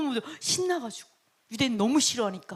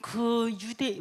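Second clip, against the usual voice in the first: usual speaking voice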